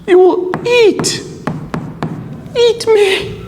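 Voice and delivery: very high, squealed mock alarm. A narrator's reading. Crane accuses hungry wolf, high and alarmed. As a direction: squeak it out in acted fright. Crane fears being eaten.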